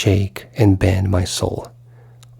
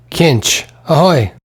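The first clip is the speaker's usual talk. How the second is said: louder, higher hail up the stairs; calling out; 4 semitones higher, 3 dB louder than usual. Acted. Buck Mulligan calling Stephen from the tower stairs, loud hail. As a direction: call him from down below.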